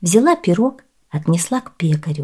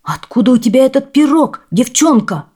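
raised, loud demanding question; startled man's loud demand; frightened baker shouting; 6 semitones higher, 5 dB louder than usual. A narrator's reading. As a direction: shout in alarm at the girl. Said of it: "Испугался пекарь, кричит".

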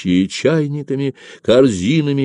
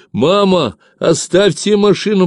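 high, loud, urgent plea; pleading cry to mother; 10 semitones higher, 4 dB louder than usual. A narrator's reading. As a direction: beg in a raised voice. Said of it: "попросил Ефим".

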